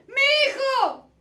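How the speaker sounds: loud, high, overjoyed calling out; a father bellowing his delight at his son's return; father's joyful shouts. Acted. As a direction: shout it to the whole house, wild with joy. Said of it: "con los gritos del padre a la familia".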